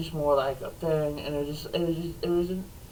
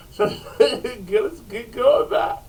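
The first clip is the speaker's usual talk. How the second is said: laughing; 4 semitones higher, 4 dB louder than usual. Retold or imitated. Fleeing, laughing.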